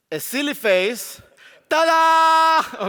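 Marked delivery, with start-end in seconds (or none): 1.7-2.9 high, loud showman's ta-da; big magician's flourish; window sound. Shown as a demonstration. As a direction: throw it out like a grand reveal. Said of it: "do the window sound".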